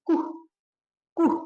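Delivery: Kuh said "koo", one long vowel; name the German letter as Kuh, "koo". Shown as a demonstration. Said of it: "dalam bahasa Jerman bilangnya". (Kuh dibaca "ku" ala Jerman)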